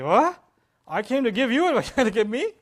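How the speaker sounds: loud, high, incredulous outburst; bruised-ego indignation. Acted. Counselor who got counseled back, loud indignant disbelief. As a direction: act affronted, voice shooting up. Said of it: "if your ego sparks and my ego sparks and we say".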